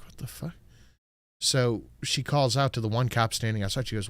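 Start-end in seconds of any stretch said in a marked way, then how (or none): 0.0-0.5 muttered under breath. Retold or imitated. Cashier muttering at the screen, quiet disbelief. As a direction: mumble it to yourself in disbelief.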